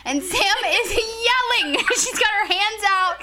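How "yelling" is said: high, drawn-out "yelling"; "yelling" raised high and held long; stress on yelling. Real, not acted. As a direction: stretch "yelling" out in disbelief. Stresses how her friend behaved.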